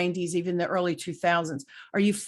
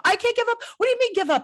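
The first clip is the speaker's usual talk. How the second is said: much higher, frantic disbelief; frantic high protest; 13 semitones higher, 3 dB louder, much faster than usual. Retold or imitated. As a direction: squeal it like a frazzled mom. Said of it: "Like a crazy person".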